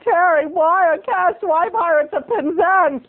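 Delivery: high, drawn-out mock questioning; imagined listener's question. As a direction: wail the question slowly in a high voice. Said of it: "I know what you're saying to yourself".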